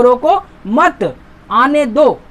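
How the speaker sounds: teacher slowly showing the comma after मत; pause after मत. Shown as a demonstration. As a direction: set मत off with a stop after it. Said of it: "मत के बाद".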